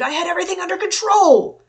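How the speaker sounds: very high, full-volume furious shout; scream. A narrator's reading. Kenton berates the men for tasing the dragon, screaming. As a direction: scream it out in exasperation. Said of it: "Kenton screamed".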